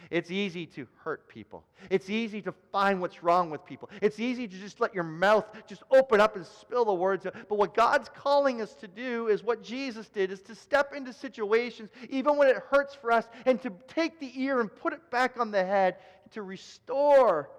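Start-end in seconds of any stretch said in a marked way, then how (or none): 5.7-17.5 preaching. Acted.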